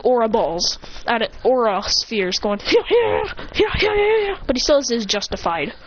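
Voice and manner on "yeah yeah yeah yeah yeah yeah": high-pitched repeated battle yelps; firing yells. Acted. Hero shooting aura spheres, high-pitched attack yells. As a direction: yelp with each shot fired.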